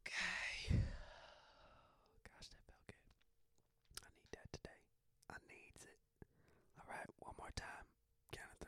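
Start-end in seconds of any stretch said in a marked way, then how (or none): 2.2-8.7 soft unvoiced whisper. Real, not acted. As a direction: breathe the words out. Whispered aside after breath hold.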